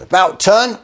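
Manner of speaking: high, sharp army command; drill order. Retold or imitated. Alexander turning his soldiers back from the cliff, barked. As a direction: call it like a sergeant.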